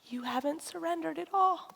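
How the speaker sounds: hushed acted correction; gentle reproof. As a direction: say it softly, as God answering. Voices God's answer to her.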